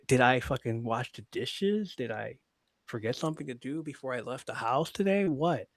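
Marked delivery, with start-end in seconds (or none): none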